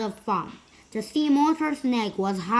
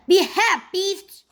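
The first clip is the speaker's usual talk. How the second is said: high, loud command across the water; calling out; 10 semitones higher, 7 dB louder than usual. A narrator's reading. Princess calls across to the sea monster, loud high command. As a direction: shout it through cupped hands.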